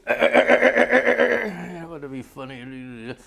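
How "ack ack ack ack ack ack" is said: much louder, higher "ack, ack" mixed with laughter; laughing gun noise. Retold or imitated. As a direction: blast the gun noise, cracking up. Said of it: "And they go".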